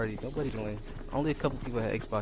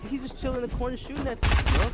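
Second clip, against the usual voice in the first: raging gamer; 11 semitones higher than usual. Real, not acted. Frustrated gamer complaining.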